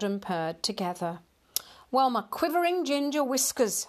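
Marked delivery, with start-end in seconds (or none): 1.9-3.8 high-pitched huffy exclamation; glaring indignation. A narrator's reading. Ignored cat glaring at man and kitten, indignant. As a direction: bristle with offended pride, pitched up. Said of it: "Hamish glared".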